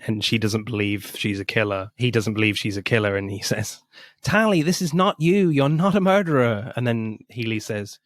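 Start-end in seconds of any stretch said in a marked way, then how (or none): 4.2-6.7 earnest plea. Retold or imitated. Gosling pleading with Tally, retold.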